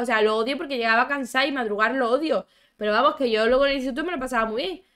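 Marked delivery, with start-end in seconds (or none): none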